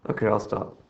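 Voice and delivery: ordinary delivery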